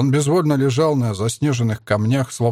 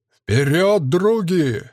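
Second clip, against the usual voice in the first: high rallying shout; battle cry; 6 semitones higher, much slower than usual. A narrator's reading. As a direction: call the men forward, high. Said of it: "возопил Калаврат".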